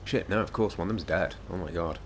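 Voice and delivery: ordinary talk, nothing marked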